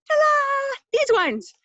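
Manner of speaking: high, loud triumphant ta-da; showman's ta-da unveiling the kit; ta-da reveal. Pretend. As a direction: present it like a magic trick.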